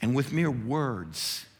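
ordinary talk, nothing marked

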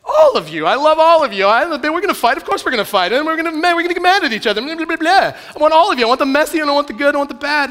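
a movie lover declaring he wants all of her, high and loud; romantic movie hero. Acted. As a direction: overdo a rom-com love speech. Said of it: "when someone in a romantic comedy says".

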